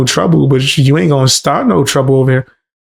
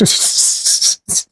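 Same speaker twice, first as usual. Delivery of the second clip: breathy laugh; 6 dB quieter than usual. Real, not acted. Laughing after the story.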